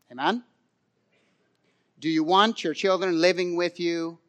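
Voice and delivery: ordinary delivery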